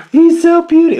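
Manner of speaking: high gasp of delight at something tiny. Real, not acted. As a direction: squeal it out, charmed by how small it is. Gasps at tiny toy car.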